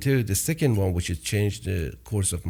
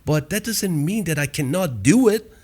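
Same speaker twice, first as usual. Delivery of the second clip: fired up; 8 semitones higher than usual. Real, not acted. Passionate message to entrepreneurs.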